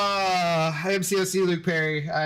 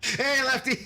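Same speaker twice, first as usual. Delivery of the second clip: teasing call; 8 semitones higher than usual. Retold or imitated. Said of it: "And I was like".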